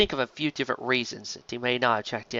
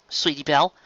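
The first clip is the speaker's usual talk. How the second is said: usual speaking voice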